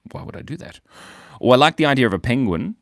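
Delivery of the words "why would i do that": whisper. Real, not acted. Aside to chat.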